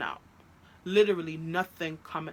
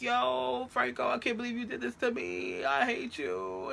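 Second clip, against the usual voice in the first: retold upset reproach; 5 semitones higher than usual. Girlfriend reproaching Franco on the phone, upset. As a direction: wail it lightly, stretching the end.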